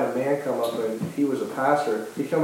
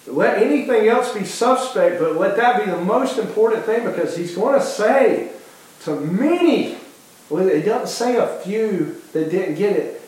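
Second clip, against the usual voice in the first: fervent preaching; 5 semitones higher than usual. Acted. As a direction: preach it with rising urgency. Preacher builds to full force.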